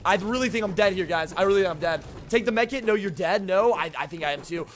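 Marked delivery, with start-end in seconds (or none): none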